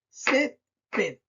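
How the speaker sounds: "Césped" split into CÉS, then ped; syllable by syllable. Shown as a demonstration. Teacher counting syllables for the class, "Césped" as CÉS, ped. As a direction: sound out "Césped" in two slow beats. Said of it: "Cuántas sílabas tiene césped".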